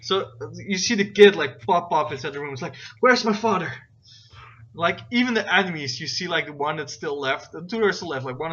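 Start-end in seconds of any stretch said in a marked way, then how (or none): 3.0-3.7 loud, higher-pitched demand; raised voice, high; kid calling out. Acted. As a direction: pipe up urgently as a kid. Kid pops up demanding his father.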